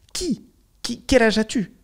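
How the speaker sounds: high-pitched incredulous questioning; mock indignation. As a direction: act baffled, voice leaping right up. S'indigne face à un étourdi imaginaire. (indignation jouée, voix très aiguë)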